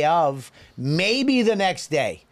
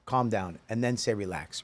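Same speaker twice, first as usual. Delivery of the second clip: soft placating; 5 semitones lower, 8 dB quieter than usual. Shown as a demonstration. Sets up calm-down demonstration.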